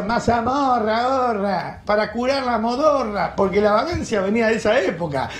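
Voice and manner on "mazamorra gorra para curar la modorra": high, slow singsong hawker's call; street vendor cry. Retold or imitated. Host imitating a colonial vendor selling mazamorra, slow high hawker's cry. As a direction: call out your wares like an old street seller. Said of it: "pasaba un afroamericano con una canasta decía".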